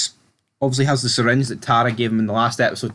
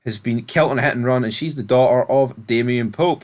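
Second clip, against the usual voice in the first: ordinary talk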